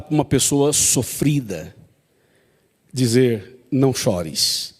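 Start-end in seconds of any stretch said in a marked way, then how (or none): 3.7-4.7 soft, slow, tender comfort. Retold or imitated. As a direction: console a grieving mother gently. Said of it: "foi muito carinhoso". (consolo terno, suave e lento)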